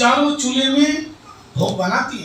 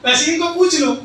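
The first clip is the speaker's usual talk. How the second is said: higher, louder insistent repeat; 7 semitones higher, 7 dB louder than usual. Acted. Husband insisting in front of the guest, louder and higher. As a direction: push the same line much harder.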